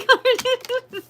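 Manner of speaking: a quick squealing laugh; high burst of laughter. Real, not acted. Reader laughing while naming the creativity card, high laugh. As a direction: laugh high right through the word.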